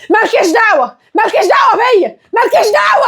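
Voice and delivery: high hysterical shouting; yelling. Acted. Daughter telling her mother to stay out, yelling. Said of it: "مالك بتزعقي ليه؟".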